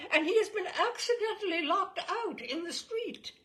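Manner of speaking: high, loud society lady through laughter; high fluting commanding matron; fluting upper-class Englishwoman. Retold or imitated. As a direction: lay on the grand dowager voice. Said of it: "fluting tones of a middle-aged, upper-class Englishwoman".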